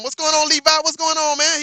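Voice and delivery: high, loud, happy hello; excited greeting. Real, not acted. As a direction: greet the viewer with a burst of joy. Greeting a viewer by name.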